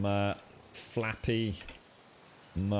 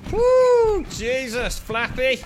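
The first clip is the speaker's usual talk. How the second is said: high, loud thrilled cheer; overjoyed cheer to his flying pet; excited whoop; 12 semitones higher, 9 dB louder, slower than usual. Real, not acted. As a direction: whoop with real delight. Thrilled after a wild flight.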